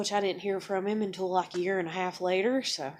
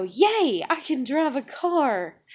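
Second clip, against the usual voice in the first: loud, higher, drawn-out mock cheer; mock excitement; 4 semitones higher, 6 dB louder, slower than usual. Daughter mimicking what her parents expect, mock glee. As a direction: play an over-the-top gleeful outburst.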